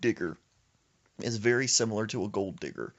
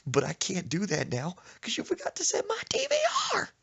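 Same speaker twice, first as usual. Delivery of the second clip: sobbing wail; 9 semitones higher than usual. Retold or imitated. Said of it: "Just screaming".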